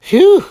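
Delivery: big high loud whew of relief; tired whew. Acted. Squirrel worn out from counting, loud whew.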